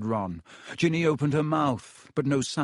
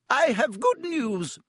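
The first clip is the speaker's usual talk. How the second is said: high, slow formal announcement; McGonagall announcing; 12 semitones higher, slower than usual. A narrator's reading. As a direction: announce it crisply. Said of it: "she said".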